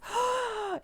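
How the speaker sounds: sudden high intake of breath; alarmed gasp. A narrator's reading. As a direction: gasp as if about to crash. Truck swerving toward a puddle.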